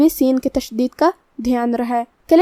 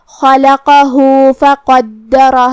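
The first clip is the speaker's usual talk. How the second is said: خلقہ ending in a long "hu" into slow فقدرہ; lengthened madd; 4 dB louder, much slower than usual. Shown as a demonstration. Teacher showing madd to learners, خلقہ lengthened at the end. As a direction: chant خلقہ with a drawn-out final vowel, then فقدرہ. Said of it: "آواز دو مد کے برابر لمبی".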